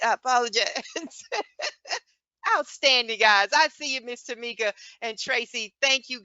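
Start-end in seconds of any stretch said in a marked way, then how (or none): 0.9-2.0 high laugh. Real, not acted. Laughs after apologizing.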